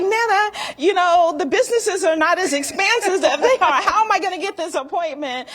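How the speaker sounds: half-laughing mock exasperation at Nana; retelling herself to Nana. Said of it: "And I'm like".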